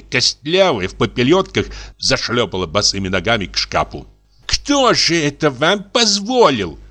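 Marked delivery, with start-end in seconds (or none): 4.5-6.8 high, slow, stern question; stern spinster. A narrator's reading. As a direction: demand it sternly. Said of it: "спросила она строго".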